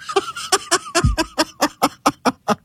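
very high staccato laughing fit; real high-pitched cackle; helpless laughter. Real, not acted. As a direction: burst into a long, high, rattling laugh. Cracking up at retold joke.

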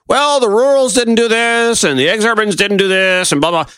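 high, mocking pundit impression; mocking parse. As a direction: parrot the overanalysers dismissively. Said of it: "everybody wants to parse".

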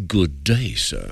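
put-on British accent. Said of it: "could I maybe do a British accent".

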